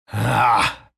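loud terrified scream; waking from a nightmare. Acted. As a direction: scream yourself awake. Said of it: "Я проснулся в холодном поту".

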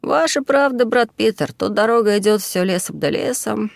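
piping, unpleasant little yes-man; squeaky voice. A narrator's reading. Junior monk agreeing with brother Peter, squeaky high. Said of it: "неприятный и песклявый".

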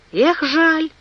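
high-pitched exclamation of mild regret; exclaiming. A narrator's reading. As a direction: cry out what a pity. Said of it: "воскликнул Пенкроф".

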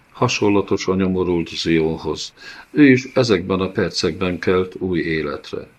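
plain reading voice

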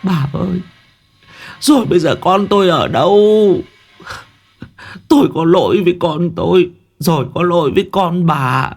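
high, sobbing plea of remorse; tearful wailing. A narrator's reading. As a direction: wail your apology to the other mother. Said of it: "Bà quay sang ôm lấy bà cụ Vân mếu máo".

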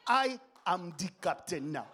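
loud mock African accent. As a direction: take command in an African accent. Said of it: "like, African".

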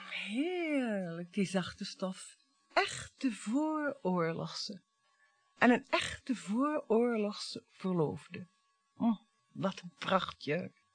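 soft, slow and lingering over each word; dreamy murmur. Acted. Wife admiring her old dress to husband, soft and slow. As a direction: savour the dress like a memory.

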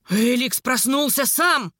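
slow, enraged snarl; drawn-out angry growl; furious growl. A narrator's reading. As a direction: growl it out in a rage, word by word. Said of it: "прорычал в бешенстве брюнет".

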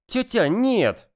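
annoyed, drawn-out protest; irritated snort. Acted. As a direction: huff it out irritably. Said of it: "фыркнула Светка".

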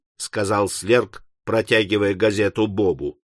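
usual speaking voice

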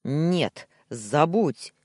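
loud, angry barked refusal. A narrator's reading. Husband forbidding his wife the call, barking. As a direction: bark both words as orders. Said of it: "рявкнул я".

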